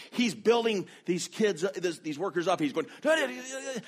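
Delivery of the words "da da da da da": high, rapid-fire nonsense hype; mock excited interpreting. Interpreter building the workers up, in high mock excited babble. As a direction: imitate an interpreter whipping up a crowd. Imitates interpreter hyping up the crowd.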